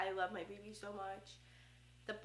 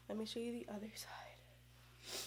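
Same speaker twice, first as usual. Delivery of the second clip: breathy whisper, much softer; 9 dB quieter than usual. Real, not acted. Vlogger turning to show viewers her side, whispering. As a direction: whisper it as you turn.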